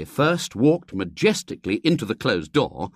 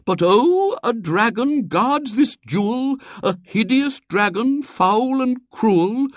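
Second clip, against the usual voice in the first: loud, high, hammy recital; theatrical poem reading; 13 semitones higher, 6 dB louder than usual. A narrator's reading. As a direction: declaim the poem with mock passion. Reads Robert's love poem aloud.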